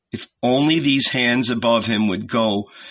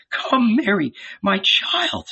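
higher-pitched motherly coaxing; coaxing older woman; 10 semitones higher, slower than usual. A narrator's reading. Salome leading Mary away, coaxing. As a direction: coax her along in a raised pitch.

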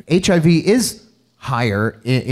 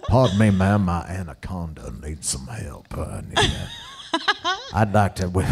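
quieter, slipping into laughter; southern gentleman; 7 semitones lower, 6 dB quieter than usual. Retold or imitated. Doing the voice for friends, breaking up laughing. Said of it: "that's it right there".

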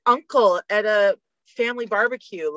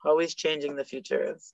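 lightly voiced low Yoda; a hint of Yoda, soft and low; 6 semitones lower, 8 dB quieter than usual. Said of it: "that's Yoda".

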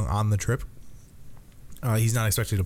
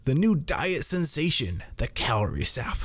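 hard sell ad; 5 semitones higher than usual. Pretend. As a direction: sell it like a TV diet ad. Mock diet ad pitch.

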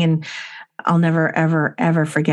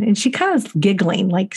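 usual speaking voice

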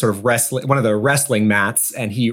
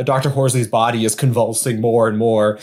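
ordinary talk

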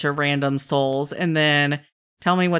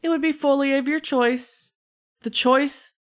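trickster's offer; 8 semitones higher than usual. Acted. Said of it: "and he goes".

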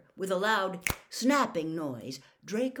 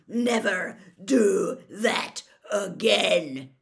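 slow, loud, word by word; Moody; 8 dB louder, much slower than usual. A narrator's reading. Moody bounces the ferret on every word, slow growled shout. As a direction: punch each word out separately. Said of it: "speaking each word as the ferret hit".

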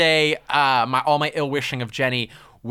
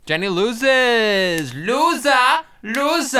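taunting; 7 semitones higher, 3 dB louder, much slower than usual. Real, not acted. Gloating over the other host losing.

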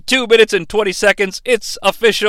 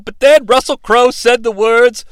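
ordinary talk, nothing marked

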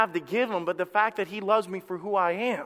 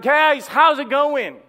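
slow, loud shout across the water, higher; calling out; 6 semitones higher, 9 dB louder, slower than usual. Acted. Jesus shouting from the shore to the fishermen, loud and friendly. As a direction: hail them cheerfully from the shore. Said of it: "Jesus cries out".

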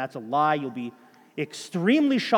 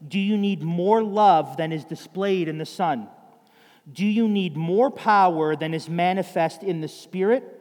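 raised, urgent preaching voice; louder and louder call to hurry; fervent preaching; 4 dB louder than usual. Acted. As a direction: plead with them, rising to a cry. Reads Spurgeon's application fervently.

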